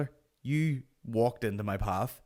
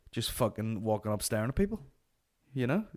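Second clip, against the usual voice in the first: usual speaking voice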